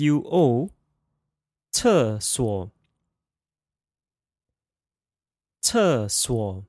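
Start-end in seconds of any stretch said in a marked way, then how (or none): 1.7-6.6 厕所 said cè suǒ, falling then low dip; 厕所 with fourth tone then third tone; practicing tones. Shown as a demonstration. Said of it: "fourth tone, third tone pair".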